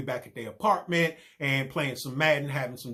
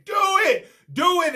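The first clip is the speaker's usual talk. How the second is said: shrill, much higher, loud scream; screamed command; 9 dB louder than usual. Retold or imitated. Woman in the bedroom screaming at Bean, shrill and loud. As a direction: shriek it through the door. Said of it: "She's the one screaming".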